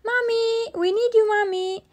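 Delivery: put-on high, quick child's call; shouting. A narrator's reading. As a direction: call out for mum as a little girl. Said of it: "they heard Peppa shout".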